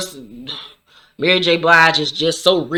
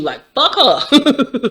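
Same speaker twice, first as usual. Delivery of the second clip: drawn-out dismissal; 8 semitones higher, much slower than usual. Real, not acted. Vlogger reacting to Monet.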